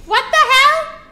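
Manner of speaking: high, loud what the hell; surprised outburst. Real, not acted. Streamer shocked by the game, yelping loud. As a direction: yelp it in disbelief.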